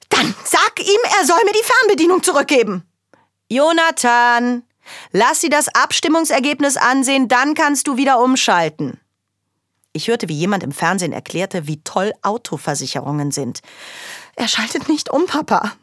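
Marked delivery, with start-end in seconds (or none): 0.1-2.9 much higher, louder and fast; indignant demand. Acted. Girl demanding dad fetch the remote, higher, louder, fast. 3.5-9.0 higher and louder call; dad calling out. Acted. Father calling to Jonathan in the other room, louder. 14.3-15.7 put-on higher child whine; whining complaint. Girl complaining to dad about her brother, higher.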